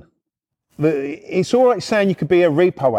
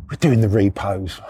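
usual speaking voice

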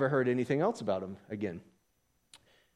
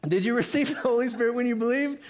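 high, rising question; animated high-pitched asking; exaggerated questioning read; 8 semitones higher than usual. Shown as a demonstration. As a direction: lift the whole question up high. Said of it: "So some of you just read it like".

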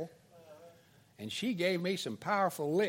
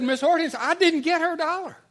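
high, loud mock protest; protesting schoolboy; 10 semitones higher, 9 dB louder than usual. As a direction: plead innocence like an accused kid. Accused boy protests to teacher.

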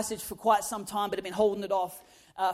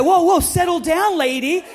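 mock alarmed guys; 4 semitones higher, 9 dB louder than usual. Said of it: "they go".

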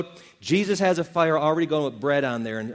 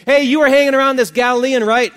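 much louder and higher challenge; loud bystander who has spotted a suspect; accusing call; 9 semitones higher, 11 dB louder than usual. Retold or imitated. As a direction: pin him down with a loud accusation. Second accuser confronting Peter.

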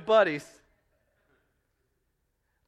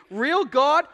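ordinary talk

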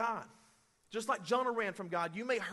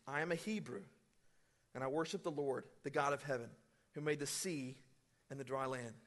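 softer, lower, subdued answer; quiet Jonah; 8 semitones lower, 8 dB quieter than usual. Acted. Jonah quietly confessing to sailors, hushed. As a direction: say it low and hushed, a hint of Jonah.

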